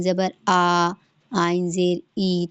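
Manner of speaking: عین said plain like hamza, no squeeze; عین flattened into a glottal stop; wrong ain as hamza. Shown as a demonstration. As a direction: show the mistake: plain آ and ای. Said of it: "آپ یہ نہیں کریے گا".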